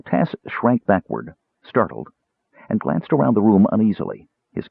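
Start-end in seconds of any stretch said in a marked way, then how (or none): none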